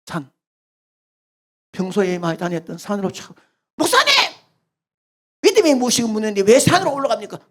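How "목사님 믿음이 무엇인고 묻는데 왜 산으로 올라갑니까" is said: much higher, fast indignant youth; a young man complaining to the pastor, high and quick; protesting young man. Acted.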